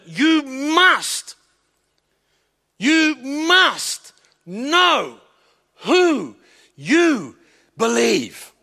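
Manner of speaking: slow, each word hammered apart; emphatic, word by word. Acted. Preacher hammering the point to the congregation, emphatic. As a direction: drive home every word, you must know who you believe. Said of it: "This is emphatic".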